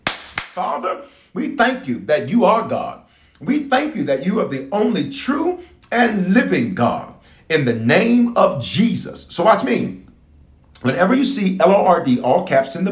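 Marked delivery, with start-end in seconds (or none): 0.1-9.3 a short, lightly voiced prayer, slowing at the end. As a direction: breathe out Father, then pray it out a little higher.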